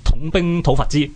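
usual speaking voice

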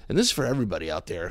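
ordinary talk

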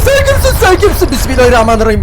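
very high, loud shrieking in panic; terrified scream. Real, not acted. Gamer facing a screaming monster, shrieking in terror. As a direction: scream at the thing that just appeared.

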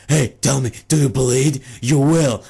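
menacing superhero impression; mock-ominous challenge, delivered in punchy bursts; Batman. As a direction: put on a forceful, threatening vigilante voice. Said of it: "And Batman's like".

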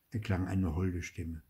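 ordinary delivery

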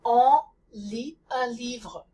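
slow "On lit un livre" with nasal "On" and "un", lips rounded, tongue back; French nasal vowels, said slowly and clearly. Shown as a demonstration. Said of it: "I say the French on sound".

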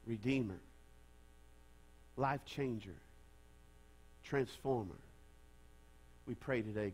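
soft, low, reverent prayer voice. Acted. Pastor praying softly over his church before the sermon. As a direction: pray it quietly over the congregation.

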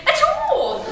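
high, loud mock British "at all"; British accent. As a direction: imitate his English "at all", high. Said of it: "The way you say".

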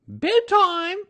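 very high, loud mother kangaroo; high-pitched, loud bedtime order; Kanga's voice. A narrator's reading. Kanga to supposed child.

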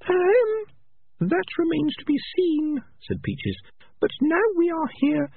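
put-on high, earnest young female rat; Peaches' voice. A narrator's reading. Peaches telling the clan leader, high young rat voice. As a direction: pitch it up for the clever young rat.

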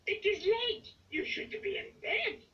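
high, excitable puppet-style character voice; Grover. A narrator's reading. Grover scolding Ernie for being up late, high character voice. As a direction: pitch it way up, quick at the end. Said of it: "says Grover".

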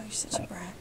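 teasing whisper under the breath. Real, not acted. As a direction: whisper it, playfully scolding. Teasing her child while playing.

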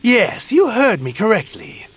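high, emphatic villain reply; haughty confirmation. Acted. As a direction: declare it with imperious relish. Villain confirms his grand plan.

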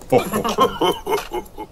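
Daddy Pig's chortle; jolly chuckle. A narrator's reading. Daddy Pig jokes about rain at barbecues, chuckling.

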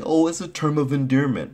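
high-pitched mimicked excuse; mocking quote. Mimicking people who defend the word, mockingly high. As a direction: parrot their excuse up high. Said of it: "You say".

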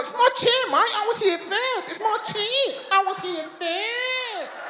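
squealing high, drawn-out Australian protest; upset Australian. Retold or imitated. Australian insists he was first, hammed-up upset Australian accent. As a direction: exaggerate an upset Australian, voice climbing way up. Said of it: "Then he got really upset".

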